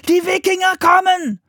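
put-on high, loud child's warning; very high urgent yell; alarm shout. A narrator's reading. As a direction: shout to warn the whole monastery. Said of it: "rief Philipp".

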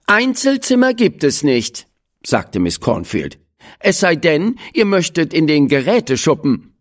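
teacher shutting down a request; lightly voiced teacher. A narrator's reading. Teacher Miss Cornfield voiced.

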